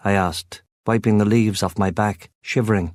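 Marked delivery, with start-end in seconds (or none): none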